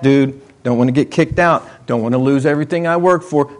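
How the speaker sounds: ordinary talk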